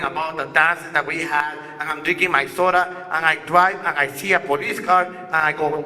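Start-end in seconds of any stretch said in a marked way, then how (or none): none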